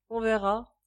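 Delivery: plain reading voice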